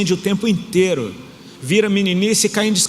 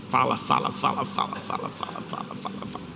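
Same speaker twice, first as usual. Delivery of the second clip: repeats sinking into a whisper; chatter fading to whisper; 3 semitones lower, 9 dB quieter than usual. Retold or imitated. Congregation joking about chatty wife, fading to a whisper. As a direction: let the chatter die away to breath. Church mocks Ali's nonstop talking.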